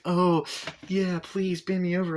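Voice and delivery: high, hammed-up whine; mock whining. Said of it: "Corey Feldman's character starts whining".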